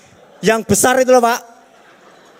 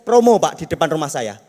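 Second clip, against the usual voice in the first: ordinary talk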